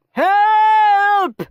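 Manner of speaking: high, loud scream for help. A narrator's reading. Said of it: "screamed Mister Nurden".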